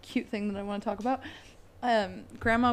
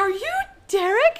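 high, louder excited question; excited disbelief; 11 semitones higher, 9 dB louder than usual. Retold or imitated. Meeting the now grown-up Derek, excited. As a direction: gush with surprise.

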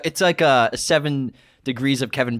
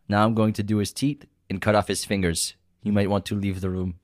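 put-on low, restrained Russian-accented threat; 4 semitones lower than usual. Host repeats quiz quote one more time, Russian accent. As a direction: repeat the line as a Russian heavy. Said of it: "This is a pretty good Russian accent".

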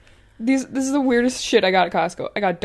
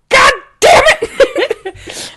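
laughing exasperated cry; 7 dB louder, much slower than usual. Retold or imitated. Retold frustration, laughing.

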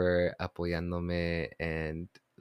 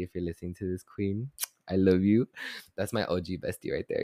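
usual speaking voice

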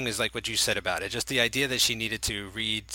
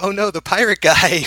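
high, loud cry of pretend alarm; mock dismay; 8 semitones higher, 9 dB louder than usual. Retelling her reaction to the movie, mock dismay. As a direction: wail it higher and louder, playing at panic.

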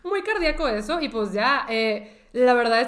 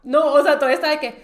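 ordinary talk, nothing marked

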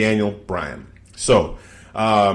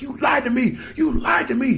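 much higher, shouted accusation; mock furious Daniel Bryan; 4 dB quieter than usual. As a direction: scream betrayal at him.

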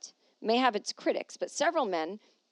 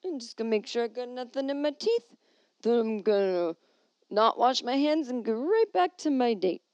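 put-on drunk guy voice; 4 semitones higher, 6 dB louder than usual. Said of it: "they're like".

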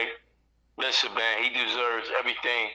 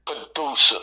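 usual speaking voice

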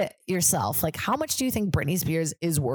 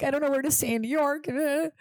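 mock panic, much higher; freaking out; 9 semitones higher than usual. Calling her manager upset, mock panic, much higher. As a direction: whine it like you're coming apart. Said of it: "freaking out".

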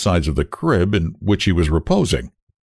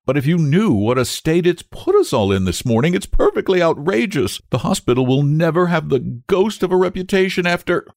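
higher-pitched, mounting outrage; indignant; 10 semitones higher than usual. A narrator's reading. Nurse complains about the hospital's reputation, outraged. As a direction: scold him, pitch climbing as you go.

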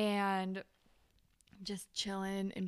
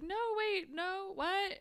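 mock dismay; 12 semitones higher than usual. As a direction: imitate a friend caught off guard. Teammate reacts to someone quitting.

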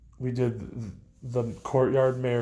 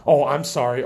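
louder, higher startled apology; 4 semitones higher, 8 dB louder than usual. Real, not acted. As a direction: caught off guard, sorry. Startled mid-recording.

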